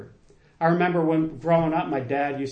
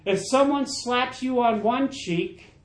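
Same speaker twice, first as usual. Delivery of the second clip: preaching at full force; 5 semitones higher than usual. Acted. Pastor pressing the congregation on forgiveness, fervent preaching. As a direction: lift the room, preach it with fire.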